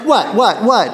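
high, laughing mock-innocent reply; hammed-up innocent little brother; innocent protest. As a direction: play it wide-eyed, all innocence, through a laugh. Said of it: "his brother's yelling at him, he goes".

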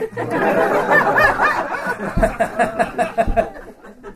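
loud, high burst of laughter; a long, real belly laugh; big laugh. Real, not acted. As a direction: crack up hard at the joke. Laughing at Elijah wanting to die.

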